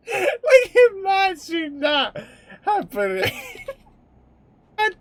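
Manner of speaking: high, loud words broken by real laughter; can barely speak for laughing; laughing hard. Real, not acted. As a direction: squeal through the laugh. Cracking up at own joke.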